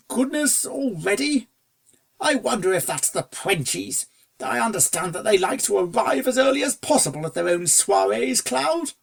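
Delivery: a hint of a startled aristocrat musing; surprised gentleman. Fancy Pants hears the bell.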